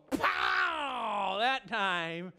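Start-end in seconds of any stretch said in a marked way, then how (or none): 0.1-1.3 sharp shouted pow, high; a big shove with more force; angel's blow. Acted. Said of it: "And he goes".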